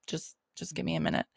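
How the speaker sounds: hurting, asking for a moment, fast under the breath; winded after a bad fall, pained and hushed. Hurt after falling, asking for time.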